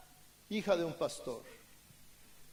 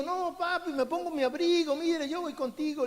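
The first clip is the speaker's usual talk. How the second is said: put-on higher, eager little boy begging his papi; a child asking to tag along, in a higher voice; pleading child; 5 semitones higher than usual. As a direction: beg like a small kid who won't be left behind.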